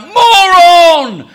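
full-voiced, high, drawn-out shout. Preacher acting out calling down the street, loud shout. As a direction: bellow it down the street. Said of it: "I shout out".

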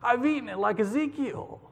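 high-pitched wonder, acted out; awed exclamation. A narrator's reading. Boy declaring he has eaten like Ezekiel, high awed voice. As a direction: lift it high, as if marvelling.

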